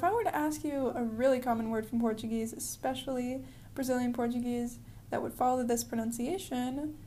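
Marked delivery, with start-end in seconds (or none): none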